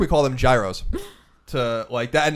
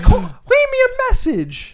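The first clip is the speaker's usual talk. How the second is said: put-on old Italian mother; 7 dB louder than usual. Son imitates his mom demanding voicemails, high and whiny. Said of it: "an old Italian woman".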